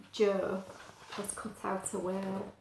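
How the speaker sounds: plain reading voice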